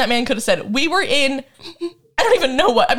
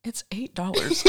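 whispered aside; 2 semitones lower, 9 dB quieter than usual. Real, not acted. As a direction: drop to a secret whisper. Whispered aside about Wi-Fi price.

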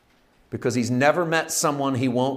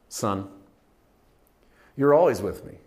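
ordinary talk

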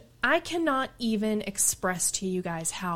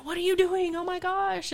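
alarmed onlookers; 11 semitones higher than usual. Retold or imitated. People reacting to her solo hikes.

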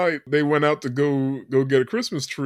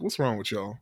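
low, soft grumble of a stern dad; gruff dad; 6 semitones lower, 10 dB quieter than usual. Retold or imitated. Podcaster voicing the stern dad at his laughing family. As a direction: mutter it like a baffled stern father. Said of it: "and he's like".

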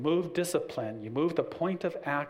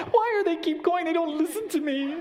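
much higher, louder mock whine, laughing; whiny helpless cop; 6 dB louder than usual. Officer whines at ignored traffic, mocking high whine. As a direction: whimper like a pushover. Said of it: "gonna sit there and go".

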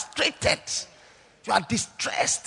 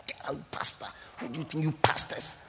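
retold yell in a hoarse whisper; mock shout whispered; 4 semitones lower, 5 dB quieter than usual. Preacher recalling the man's shout, whispered. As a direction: hiss the dying man's rebuke. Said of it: "I remember how he shouted".

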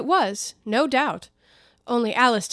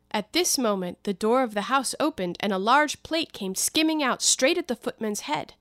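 usual speaking voice

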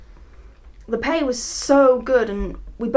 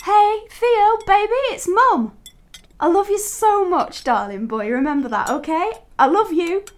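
put-on high, loving baby talk; 8 semitones higher than usual. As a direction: coo at your baby son. Mother talking to baby son.

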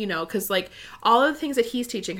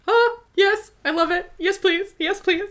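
much higher, louder delighted squeal; gushing excitement; 11 semitones higher, 7 dB louder than usual. Retold or imitated. Fan re-enacting her reaction to a possible couple, gushing excitedly. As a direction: re-enact an overjoyed fan, high and loud. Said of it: "immediately I was like".

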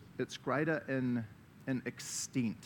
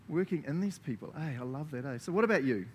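ordinary talk

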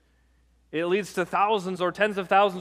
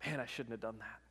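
regretful mutter; 7 semitones lower, 17 dB quieter than usual. Retold or imitated. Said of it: "then you go".

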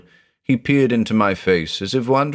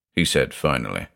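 usual speaking voice

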